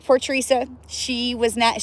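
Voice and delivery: usual speaking voice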